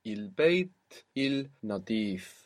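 البيت النظيف word by word, slowly; slow demo. Shown as a demonstration. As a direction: separate البيت and النظيف with a pause, L kept. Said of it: "if we say it slowly".

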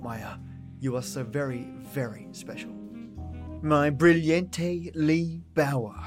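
usual speaking voice

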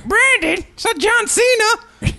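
high, slow teasing voice; mock scolding. Teasing Brandon about Gary Keeler in a high slow voice. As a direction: scold him in a silly high voice.

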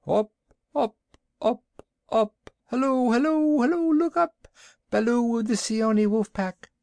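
very high bird cry from far overhead; calling kite. A narrator's reading. The kite hails Baloo from the sky, high urgent calling. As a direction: hail them in a high, piping bird voice.

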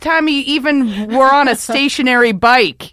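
loud, high-pitched mock tirade; indignant heckling. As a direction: lay into them like an outraged fan. Heckling critics in acted rant.

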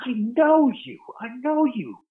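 high, insistent imitation of Branson recognizing someone; mock-excited "I know you" in a high put-on voice; Richard Branson, high. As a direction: pitch way up, a hammed-up tycoon voice. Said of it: "I hear this voice going".

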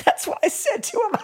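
laughing high through the words. Real, not acted. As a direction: break into laughter while telling it. Laughing at her own story.

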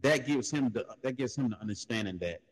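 ordinary talk, nothing marked

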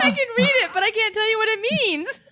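high, giggly admission; laughing. Real, not acted. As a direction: talk through real giggles. Giggling at her own confusion.

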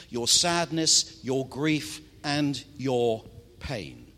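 ordinary talk, nothing marked

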